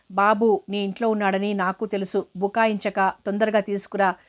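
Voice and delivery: ordinary delivery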